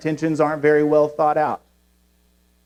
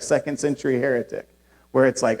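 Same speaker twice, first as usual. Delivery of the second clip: normal talking voice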